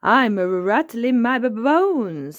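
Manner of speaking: high stuttering skeleton rattle; loud, juddering playful child's voice; Peppa. A narrator's reading. Child in skeleton costume, shaking the words out. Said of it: "shaking her arms and legs".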